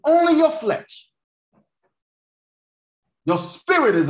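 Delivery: full-force preaching, high and loud. Real, not acted. Preacher declaring to congregation the spirit conquers depression, full force.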